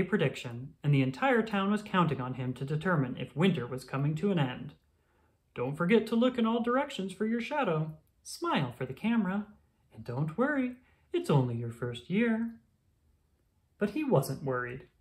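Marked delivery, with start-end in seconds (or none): 5.5-12.6 higher, fond send-off voice; a lightly voiced parent cheering him on; encouraging parent. Parent sends Groundhog off.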